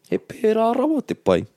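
very high swooping pitch on rawa; over-the-top enthusiastic intonation, peak on rawa; emphatic intonation. Shown as a demonstration. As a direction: push rawa way up in pitch, gushing. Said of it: "You can hear it in, in the intonation".

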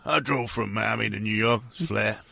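very low, soft athlete impression; Shaquille O'Neal. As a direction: drop down low and quiet, as the big man. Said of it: "he's quite low".

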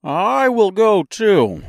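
high, loud, slow character voice; high-pitched straw man volunteering; Scarecrow. A narrator's reading. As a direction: declare it high and drawn out. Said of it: "declared the Scarecrow".

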